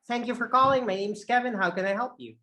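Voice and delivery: upbeat call center greeting; peppy customer service opener; high energy. Shown as a demonstration. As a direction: answer the phone brightly, starting high and loud. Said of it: "High energy".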